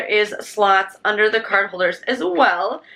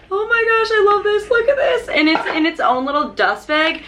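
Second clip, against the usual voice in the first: excited squeal; 9 semitones higher than usual. Real, not acted. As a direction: shriek with joy, laughing. Squealing at the wallet box.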